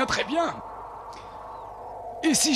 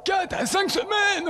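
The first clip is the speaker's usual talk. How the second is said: higher, slow astonished exclamation; startled, drawn-out question; dismayed surprise; 7 semitones higher than usual. Acted. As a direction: react with shock at the delay. Shocked at long wait.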